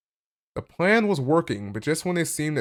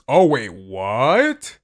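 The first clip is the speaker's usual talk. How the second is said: stretched-out, loud shocked wait what; slow, loud real disbelief; 7 dB louder, much slower than usual. Real, not acted. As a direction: drag it out in disbelief. Shocked by a plot twist.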